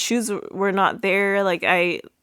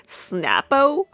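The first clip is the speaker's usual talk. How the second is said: higher, rising incredulous echo; playful disbelief, pitched up at the end; mock disbelief; 6 semitones higher than usual. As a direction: echo it as an amused question. Echoes the joke word in disbelief.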